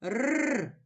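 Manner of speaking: "Р" as a lone hard trill; hard r sound. Shown as a demonstration. Teacher isolating the first sound of рак, hard r. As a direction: roll a hard, non-palatalised "Р" by itself. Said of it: "твердий".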